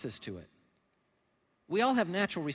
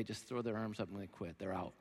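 ordinary talk, nothing marked

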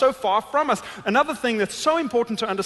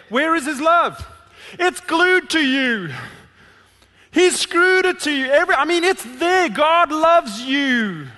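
raised, higher impassioned preaching; preaching at full force; 4 semitones higher, 3 dB louder than usual. Acted. Pastor urging the congregation that God's love is glued to them, raised and impassioned. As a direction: drive it home to the congregation, loud and lifted.

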